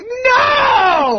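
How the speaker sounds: very high, stretched-out mock bawl; hammed-up toddler wail; wailing kid. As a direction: imitate a small child howling a refusal. Imitating the kid's tearful refusal.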